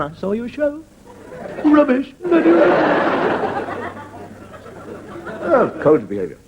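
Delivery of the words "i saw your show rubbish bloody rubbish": high, drawn-out sneer on Bloody rubbish; mock sneering critic. Friend in a car mouthing a slow, sneering insult. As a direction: stretch out the insult slowly. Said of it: "She could be sitting there saying".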